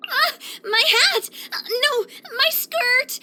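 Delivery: high-pitched, panicky little cries; flustered alarm. Acted. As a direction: cry out as the wind grabs your clothes. Wind snatching hat and skirt.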